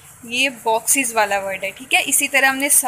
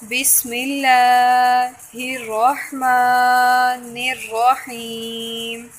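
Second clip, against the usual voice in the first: slow, chanted Arabic recitation; measured Tajweed chant of the Ta'awwudh; Quranic recitation; 3 dB louder, much slower than usual. Shown as a demonstration. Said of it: "تسمية".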